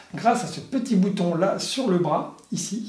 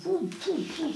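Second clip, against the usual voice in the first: high, soft swooshing pouf sounds; lightsaber whoosh noises; 13 semitones higher, 7 dB quieter than usual. Retold or imitated. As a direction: mimic a saber swinging through the air. Imitating lightsaber swings.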